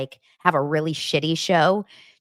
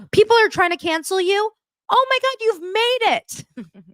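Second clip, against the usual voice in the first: much higher, louder gushing friend; excited friend; 5 dB louder than usual. Retold or imitated. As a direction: gush like a thrilled friend on the phone.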